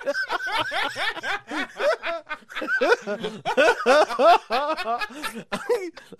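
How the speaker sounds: long, high-pitched laughing fit; real helpless laughter. Real, not acted. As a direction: crack up loudly at your own bad joke. Cracks up at own fake accent.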